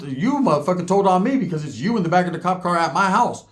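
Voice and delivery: normal talking voice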